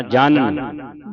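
ਜਾਣਨ drawn out slowly, retroflex ṇ first then dental n; slow teaching model of ਜਾਣਨ; careful pronunciation. Shown as a demonstration. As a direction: stretch ਜਾਣਨ syllable by syllable so both nasals are heard. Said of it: "ਪਹਿਲਾ ਨਾਣਾ ਹੈ, ਫਿਰ ਨੰਨਾ ਹੈ".

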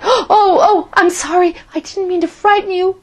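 high, breathless and startled; nervous high apology with a laugh; flustered apology. A narrator's reading. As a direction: blurt out sorry in a fluster.

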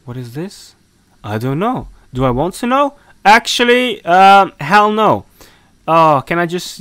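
ordinary delivery

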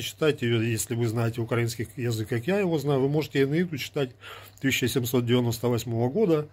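ordinary talk

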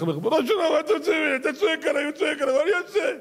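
high-pitched, hammed-up wail; tearful complaint. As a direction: plead like a harried messenger caught between two bosses. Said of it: "המלאך בוכה". (יללה מומחזת בקול גבוה)

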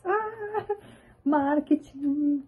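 very high, slow mock exclamation; teasing mock. Real, not acted. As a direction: make fun of the slogan. Mocking the printed slogan.

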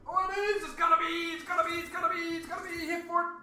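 high, loud excited yells at the slot; screaming hope for the bonus; real excitement; excited yelling. Real, not acted. Slot bonus about to land.

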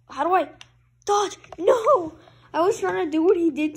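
loud, high, frantic outburst; panicked yelling. Real, not acted. Gamer losing an in-game fight, panicked yelling. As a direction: climb into a loud, panicked cry.

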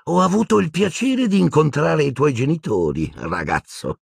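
high, malicious gloating; gleefully wicked woman; Bellatrix. Acted. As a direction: taunt in a high voice, dropping on the last word. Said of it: "un sorriso di pura malvagità".

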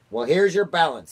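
high, loud, mild snarl. A narrator's reading. Miss Tibbs rebuking the chief spy, mild loud snarl. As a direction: snap it loudly with a touch of a snarl. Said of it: "snarled Miss Tibbs".

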